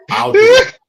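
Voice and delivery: shouted, very high, mock eager; loud high exclaim. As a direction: yell it like a thrilled volunteer. Joke offer to do voices.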